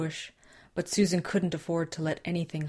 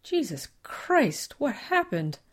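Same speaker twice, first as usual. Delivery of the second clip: shocked; 8 semitones higher, slower than usual. Acted. Driver sees blood-soaked girl.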